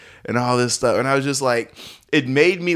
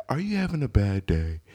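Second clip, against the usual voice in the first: soft, unruffled question; acted calm, voice dropped softer; zen calm; 6 dB quieter than usual. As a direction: ask it gently, as if fresh from yoga. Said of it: "very zen in my approach".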